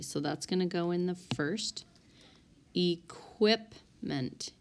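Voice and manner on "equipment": slow, with gaps between syllables. Shown as a demonstration. Teacher sounding out a spelling word for students, syllable by syllable. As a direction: break "Equipment" into separate, drawn-out syllables.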